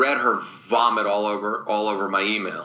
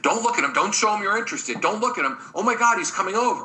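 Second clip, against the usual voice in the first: put-on high-pitched, flustered girlfriend; urgent mock warning to a girlfriend; put-on high voice, all aflutter; women playing a game; 10 semitones higher, faster than usual. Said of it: "the women are already playing a game".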